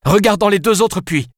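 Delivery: firm command; ordering. A narrator's reading. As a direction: tell him what to do, no argument. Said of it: "ordonna-t-elle". (Alice donne un ordre ferme)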